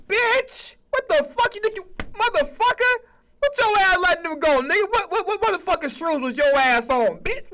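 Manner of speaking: high mock rant at the ESPN bosses; mock angry yelling. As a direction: scream at them in disbelief.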